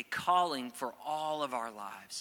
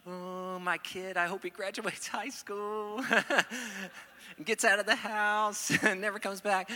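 nervous fake smile; 6 semitones higher than usual. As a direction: force a grin and giggle through the worry. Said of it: "fake smile or nervous".

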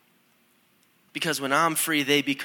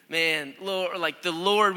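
usual speaking voice

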